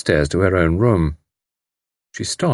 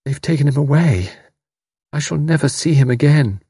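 mournful; 7 semitones higher than usual. A narrator's reading. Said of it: "her voice was like a mourning harp".

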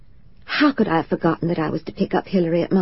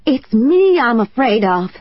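cried out loud; 6 semitones higher, 4 dB louder than usual. A narrator's reading. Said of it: "cried out as loudly as I could".

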